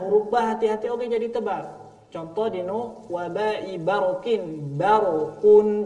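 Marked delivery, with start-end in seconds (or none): 4.8-5.8 barokun drawn out, louder; slow loud barokun. Shown as a demonstration. Teacher models barokun for students. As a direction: stretch barokun.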